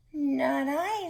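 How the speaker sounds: high, lightly voiced cat refusing; a high little cat saying no. Cat turning down the hen, high and light.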